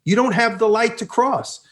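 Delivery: high, drawn-out mock yell; retold yell. Rider to crowd blocking the Vespa, retold yell. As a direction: call out high at people in the way. Honking at pedestrians in his path.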